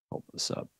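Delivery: whispered to himself, voiceless; under his breath while holding something up; a voiceless aside to himself; muttered aside. Real, not acted.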